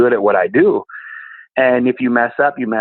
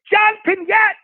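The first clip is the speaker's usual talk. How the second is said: very high, drawn-out mock holler; John Pinette phone holler; slower than usual. Comedian phoning the comic's dad, high mock holler. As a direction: call out a name in a piercingly high voice. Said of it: "And John goes".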